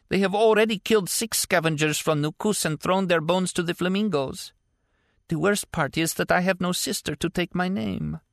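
put-on a scared girl telling Martin of their fate; frightened girl. A narrator's reading. Girl continues.

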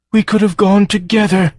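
high, far-off creature voice, wistful; sorrowful farm creature. Acted. The dying farm telling Joe, high wistful creature. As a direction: mourn what could have been.

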